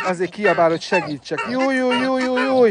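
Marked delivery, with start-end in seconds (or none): 1.5-2.6 high, quick placating yeses; flustered high soothing. Real, not acted. Keeper placates pecking ducks in a high flustered voice. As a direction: fuss to calm the birds down.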